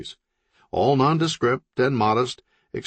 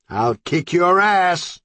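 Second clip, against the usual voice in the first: slow, louder, higher threat; angry threat; 9 semitones higher, 5 dB louder, slower than usual. Acted. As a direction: threaten him, drawn out. Threat through wall.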